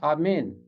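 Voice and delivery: आमीन pronounced 'aamen', e in the last syllable; Hebrew pronunciation. Shown as a demonstration. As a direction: say आमीन as Hebrew 'aa-men'. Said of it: "इब्रानी तलफ़ुज़ है".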